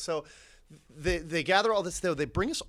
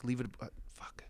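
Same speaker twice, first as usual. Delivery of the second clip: hushed unvoiced aside; whispered to self; 4 dB louder, faster than usual. Himself deciding to let the laughing cops go, whispered. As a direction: whisper it under your breath. Inner voice telling himself drop it.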